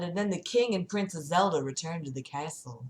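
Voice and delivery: plain reading voice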